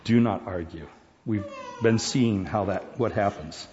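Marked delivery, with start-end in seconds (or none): none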